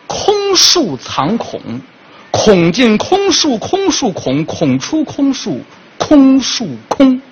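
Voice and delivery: slow deliberate couplet chant; slow recitation. Pretend. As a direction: recite it slowly like a poem. 慢念上联. (慢悠悠吟诵对联)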